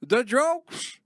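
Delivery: high, loud mock alarm; hammed-up panic; dramatic vampire. Vampire bit continues.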